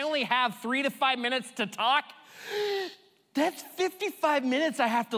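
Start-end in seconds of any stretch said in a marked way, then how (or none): 2.3-2.9 mock gasp. Talker limited to a few minutes, gasping in mock horror. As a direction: gasp in exaggerated shock. Mock shock at short talking time.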